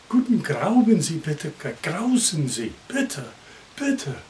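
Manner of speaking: mock German gibberish, rising on the last bitte; hammed-up pseudo German phrases; fake German. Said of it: "in my best fake German".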